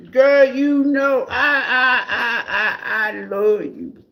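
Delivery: high, wailing "I love you" with a fake sob; mock crying plea. Ex-partner crying to the reader, hammed-up high sobbing plea. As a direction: ham up a tearful begging ex.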